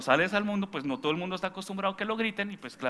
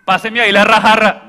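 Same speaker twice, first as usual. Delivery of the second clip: shouted, much louder order; loud demand; 6 semitones higher, 18 dB louder than usual. Retold or imitated. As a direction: bark for the jug at the table. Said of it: "No, hágame el favor".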